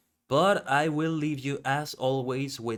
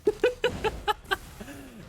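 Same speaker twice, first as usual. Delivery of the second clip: high laugh; 12 semitones higher than usual. Real, not acted. Laughing during fight.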